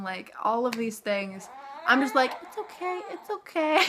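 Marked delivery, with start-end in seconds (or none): 2.4-3.8 soothing newborn. Real, not acted. Soothing her crying newborn.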